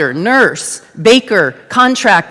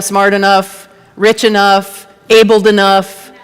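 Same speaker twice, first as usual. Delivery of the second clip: normal talking voice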